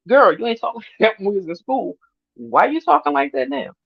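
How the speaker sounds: high-pitched teasing disbelief; incredulous challenge. Retold or imitated. Challenging her cousin on how she talks now, incredulous. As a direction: call her out, playfully baffled.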